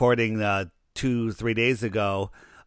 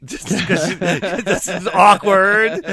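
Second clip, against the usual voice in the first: awkward laugh; 8 semitones higher, 8 dB louder, slower than usual. Real, not acted. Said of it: "you know, awkward".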